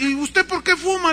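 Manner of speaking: high, loud comeback; acted indignant boy; cheeky child retort. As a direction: fire the question back at dad.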